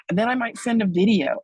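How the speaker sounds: excited. Acted. Same line repeated, louder and higher.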